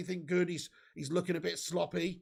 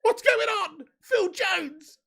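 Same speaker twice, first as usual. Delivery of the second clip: high, loud disbelieving cry; amazed shout; 12 semitones higher, 7 dB louder, much slower than usual. Real, not acted. Fan stunned by Phil Jones's defending, amazed shout. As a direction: call out in astonished delight.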